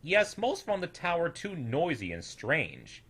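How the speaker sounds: ordinary delivery, nothing marked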